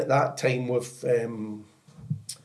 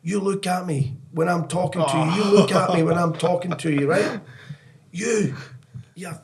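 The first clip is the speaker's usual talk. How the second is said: higher, building into a mock yell; imitating a coach screaming at a player; a half-acted furious manager; 6 semitones higher than usual. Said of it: "he starts screaming".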